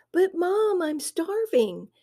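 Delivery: put-on high whining teen son; nasal whine. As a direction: whine like a starving teenager. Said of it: "he whined".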